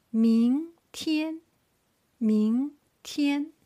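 明天 with 明 rising, 天 held high and level; second-tone demo. Shown as a demonstration. Teacher models second tone for learners, 明天 rising then high level. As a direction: lift 明 upward, sustain 天 high. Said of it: "Starting from second tone".

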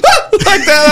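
laughing high mock shriek; imitating a lady's scared scream. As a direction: squeal like a startled walker. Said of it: "And she's like".

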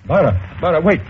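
urgent call after her, rising. Acted. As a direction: call her back before she's gone.

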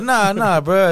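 pitched high, placating; nervous high-voiced denial. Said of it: "And I was like".

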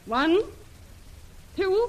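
tense count. Acted. Lil counting down at gunpoint, pitched high. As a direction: raise the pitch as the threat peaks.

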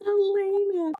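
high, lingering coo. Real, not acted. Echoing the baby name again.

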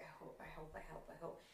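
quiet repeated hoping; hushed hoping. Retold or imitated. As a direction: murmur the wish to yourself. Hoping for day off.

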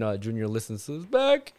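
usual speaking voice

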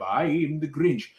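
slow, borderline British Grinch, lightly voiced; a touch of Karloff's Grinch, drawn out slow; Boris Karloff Grinch. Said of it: "Boris Karloff doing a very deep voice".

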